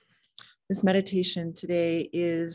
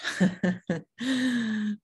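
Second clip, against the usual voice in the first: self-amused chuckle; real, easy laughter; light laugh; 5 semitones higher than usual. Real, not acted. Laughs at own hair change.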